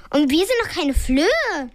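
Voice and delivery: ordinary delivery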